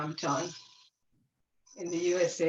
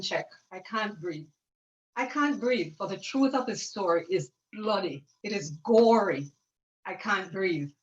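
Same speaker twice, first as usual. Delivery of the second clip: spoken word poem; 2 semitones higher than usual. Acted. Sister sharing her poem with the group, recited as spoken word. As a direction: recite it like a spoken word piece, building to a cry and fading out.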